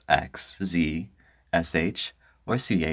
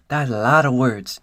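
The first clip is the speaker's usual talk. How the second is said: louder, higher, projected delivery; raised, emphatic voice; performed take; 4 semitones higher, 6 dB louder than usual. Acted. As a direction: say it out for the recording. Same line redone as performed take.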